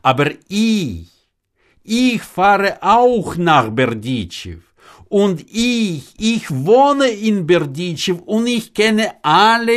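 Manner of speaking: put-on high, emphatic old man building his case; worked-up, emphatic argument; insistent explaining. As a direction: hammer each point with rising pitch.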